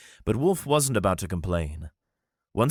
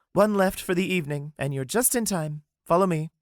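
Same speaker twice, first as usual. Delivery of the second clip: higher, helpful hostess voice; lightly voiced attendant; 11 semitones higher than usual. Attendant leading guests to the last table. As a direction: show the guests the way.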